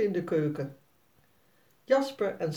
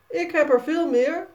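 put-on higher and louder bragging boy; opschepperig kind; 9 semitones higher, 6 dB louder than usual. A narrator's reading. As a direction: boast like a cocky little boy. Jasper overtroeft Sanne. (hoger en luider opscheppend jongetje)

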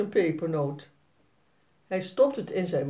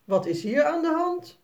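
a touch of a surprised mother, higher; verbaasde moeder; 9 semitones higher than usual. A narrator's reading. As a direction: ask like a startled mother walking in. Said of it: "vraagt ze verbaasd". (een vleugje verbaasde moeder, hoger)

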